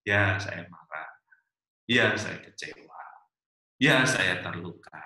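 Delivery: low, slow, measured confession; honest, open admission. Acted. Spouse admitting hurt to partner, honest and open. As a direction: state each feeling plainly, with falling pitch. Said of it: "dengan jujur dan terbuka".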